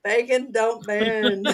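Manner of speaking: slow, hammed-up Southern drawl on bacon don't bend; full-on Southern accent. To the audience, a slow Southern punchline drawl. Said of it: "Y'all get the full-on Southern accent".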